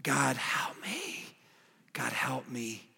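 breathless whisper, slow; whispered plea. Retold or imitated. Desperate soul whispering to God for help, slow whisper. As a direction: plead under your breath. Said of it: "It was a cry out".

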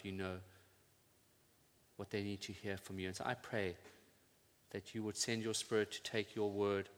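soft, unhurried prayer voice; pastoral prayer. Acted. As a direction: pray gently over the people. Pastor prays for congregation.

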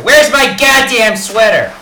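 put-on grumpy old man yelling for his sweater; angry outburst. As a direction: blow up in frustration. Rogers loses temper over sweater.

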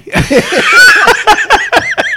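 high loud howl of laughter; shrieking laugh. Real, not acted. Cracking up at nickname.

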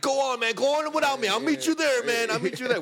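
high, hammed-up dying-soldier plea; mock wounded soldier. Telling friends to go on without him, hammed-up war plea. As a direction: play it like a war movie hero falling behind. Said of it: "and we're like".

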